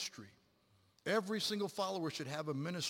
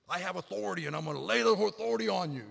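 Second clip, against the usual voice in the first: louder, higher mock domineering; heavy-handed authority; 4 semitones higher, 7 dB louder than usual. Preacher mimics someone forcing others to follow, mock domineering. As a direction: lay down the law, mockingly. Imagined bully enforcing the example.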